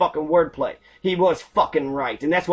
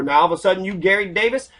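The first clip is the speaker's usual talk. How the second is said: ordinary talk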